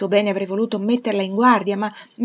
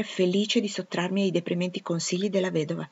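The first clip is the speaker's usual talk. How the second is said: ordinary delivery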